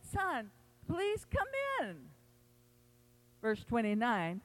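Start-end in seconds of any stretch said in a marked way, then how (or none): 0.0-2.1 high, soft, slow plea; pleading father. Retold or imitated. Father pleads softly with his angry son on the porch. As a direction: gently coax him inside. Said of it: "pleaded with him".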